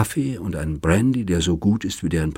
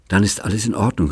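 usual speaking voice

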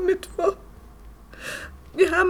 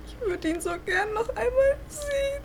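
tearful; 6 semitones higher, 5 dB quieter than usual. Acted. Grieving girlfriend wishes to see him.

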